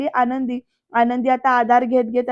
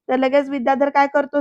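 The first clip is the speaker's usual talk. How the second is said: ordinary delivery, nothing marked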